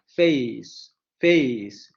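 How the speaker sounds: "Face" with a strong first vowel and a weak glide; stress on the first vowel. Shown as a demonstration. Teacher showing where the stress falls, careful. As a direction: lean on the start of "face". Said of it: "la tonicidad cae en la primer vocal".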